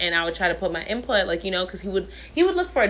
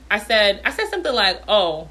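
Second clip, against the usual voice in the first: normal talking voice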